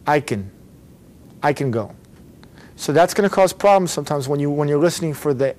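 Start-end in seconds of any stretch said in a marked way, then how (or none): 0.1-1.9 "can" shortened to "kun"; weak "can", C right into N; reduced can. Shown as a demonstration. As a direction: model "can" with the vowel nearly gone. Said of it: "Again, repeat".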